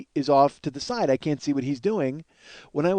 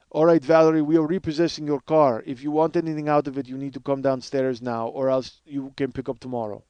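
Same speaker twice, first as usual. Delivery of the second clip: Russian repo man laying down terms; Russian accent. Acted. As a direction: do the Russian guy giving notice. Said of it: "I love doing this Russian guy's voice".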